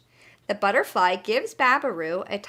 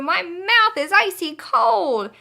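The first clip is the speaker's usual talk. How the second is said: higher, louder and slow; excited shout; 9 semitones higher, 7 dB louder, slower than usual. Acted. Babaroo after toothpaste, high loud slow shout. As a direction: cry it out, mouth freezing. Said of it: "shouts Babaroo".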